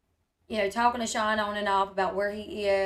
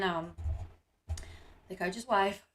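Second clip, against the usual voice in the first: ordinary delivery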